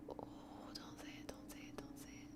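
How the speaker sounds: barely voiced, quick whisper; hushed dread. Real, not acted. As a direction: whisper it fast in dread, almost no voice. Dreads the line coming next.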